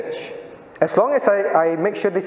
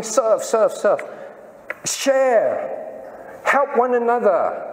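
mock harping; 5 semitones higher, much slower than usual. Pastor mimicking the church's repeated calls, higher, nagging. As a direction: parody the church's endless nagging. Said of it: "the church keep harping".

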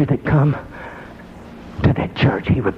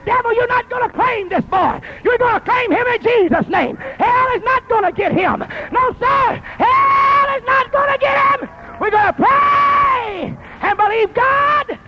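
rebuking the devil with a full, high shout; last line stretched slow, shouted; preacher's shout. Retold or imitated. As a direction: cast him out at the top of your voice. Preacher's retold prayer against the devil.

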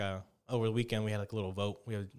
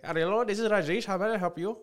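high, louder, chirpy Indian call-centre greeting; mock Indian call-centre accent; 7 semitones higher, 5 dB louder than usual. Friend imagines the call-centre agent he prefers, in a mock Indian accent. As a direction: put on a cheerful Indian support agent. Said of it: "I would prefer the Indian dude".

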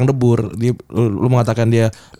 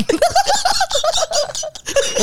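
high squeal of laughter; 3 dB quieter than usual. Real, not acted. Laughs at Frisian slip.

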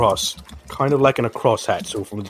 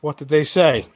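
high, eager question; woman turning to the newcomer for news; 6 semitones higher than usual. Acted. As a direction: lean in wanting the news. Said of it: "She says".

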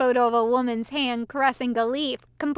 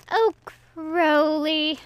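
slow, drawn-out whine, a bit higher; whining; 4 semitones higher, much slower than usual. A narrator's reading. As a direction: stretch the name out in a plaintive whine. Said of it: "Aziraphale whined".

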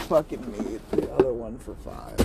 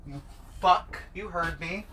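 ordinary talk

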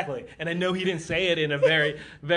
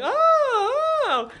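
very high, drawn-out mock wail; mock distress; 3 dB louder than usual. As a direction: imitate a badly acted cry of alarm. Mocking actor standing there in distress.

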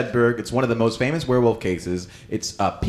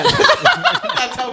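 laughing mock; 6 dB louder than usual. Mocking Diana, laughing.

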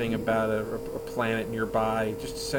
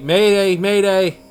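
high, loud desperate mayday; desperate shout; 8 semitones higher, 13 dB louder than usual. Acted. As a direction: yell it into the radio. Pilot calling Starfleet again.

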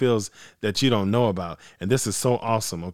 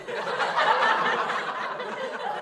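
airy breathless laugh; mocking laugh; 7 dB quieter than usual. Real, not acted. Fan laughing about Mitch Trubisky, airy laugh. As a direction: laugh it off quietly.

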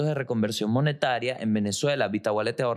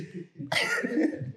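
high laugh; 11 semitones higher, 4 dB quieter than usual. Real, not acted. Laugh after joke.

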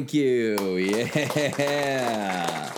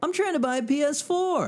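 squeaky high voice; high silly voice; 13 semitones higher than usual. Acted. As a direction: pitch it way up, playful.

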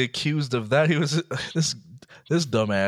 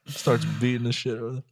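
ordinary talk, nothing marked

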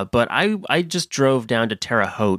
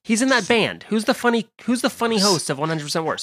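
lightly voiced celebrity, pitched higher; a hint of a star fishing for a name; 7 semitones higher than usual. Celebrity guest asking after the funny host, lightly impersonated.

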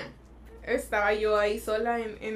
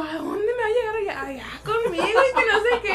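retelling herself through laughter; 9 semitones higher, 6 dB louder than usual. Retold or imitated. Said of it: "Y yo".

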